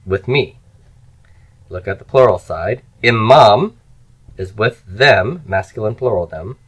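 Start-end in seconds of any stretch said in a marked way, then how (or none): 3.0-3.8 loud, clear model for students; repeat-after-me model. Shown as a demonstration. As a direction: project the word for the class to echo. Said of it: "just repeat after me".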